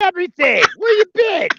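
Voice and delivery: high, very loud teasing yell; mock shouting. Man acting out friends telling coworker she missed out, mock shouting. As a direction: holler it in playful disbelief.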